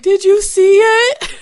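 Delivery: high, loud weepy question, slowed; hammed-up sob as mom asks; crying mom. Daughter imitates mom sobbing on the phone, high wail. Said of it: "she's like".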